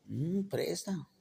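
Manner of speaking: flirty come-on. Acted.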